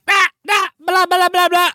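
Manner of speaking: high squeaky blah-blah; mock babble. Parodying his own rambling in a silly squeak. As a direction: babble nonsense in a cartoon squeak. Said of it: "We're gonna be like".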